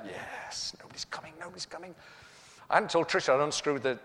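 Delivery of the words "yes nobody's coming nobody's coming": whispered glee; husband whispering triumph at the window; gleeful whisper. Retold or imitated. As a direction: hiss it to yourself in delight. Secret glee.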